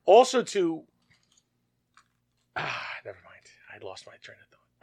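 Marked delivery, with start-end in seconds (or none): 2.6-4.8 soft unvoiced whisper. Real, not acted. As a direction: whisper it, giving up. Trails off and gives up.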